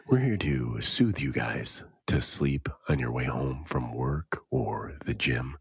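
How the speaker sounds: hushed sleepy radio host; soothing whisper. Pretend. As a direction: whisper them gently to sleep. Mock sleep show.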